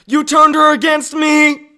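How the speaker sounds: drawn-out, high, furious shout; slow high-pitched yell of betrayal; enraged accusing shout. Acted. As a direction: hurl the accusation at full volume. Acted Anakin and Obi-Wan quarrel.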